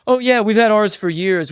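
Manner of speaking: high, loud, smug one-upping voice; a mock show-off topping a coworker's boat story; bragging one-upper. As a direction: toss it off like yours is nothing new. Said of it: "they're like".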